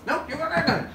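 fast squeaky refusal; quick high brush-off. Acted. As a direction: rush out a high-pitched no. Kermit brushes him off.

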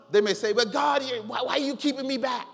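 ordinary talk, nothing marked

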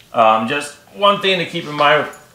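usual speaking voice

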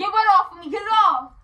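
high, loud retort; angry yelling. Acted. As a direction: snap back loudly in a quarrel. Prank quarrel, yelling back.

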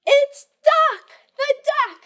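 high cartoon duck reveal; silly duck voice. Voicing animals in picture book.

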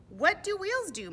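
put-on high, loud child-like question; curious young donkey. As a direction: ask Mama in a high storybook voice. Young donkey asks its mother.

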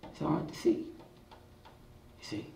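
ordinary talk, nothing marked

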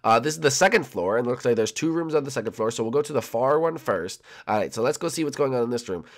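ordinary talk

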